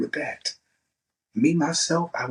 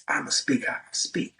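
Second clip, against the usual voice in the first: normal talking voice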